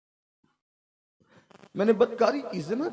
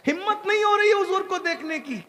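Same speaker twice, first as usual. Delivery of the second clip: impassioned plea; 11 semitones higher, 3 dB louder than usual. Acted. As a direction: plead at a high, sustained pitch. Weeping man's plea at the door. (ऊँचे सुर में बेबस फ़रियाद)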